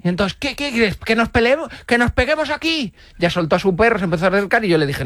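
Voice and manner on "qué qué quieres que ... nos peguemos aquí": angry challenge. Retold or imitated. Same man keeps confronting.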